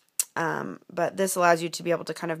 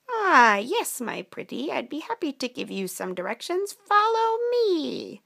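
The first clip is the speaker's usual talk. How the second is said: wheedling witch, soaring on the last words; storybook witch; 7 semitones higher, 3 dB louder than usual. Acted. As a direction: lure them along, stretching the final call. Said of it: "they came upon an ugly witch".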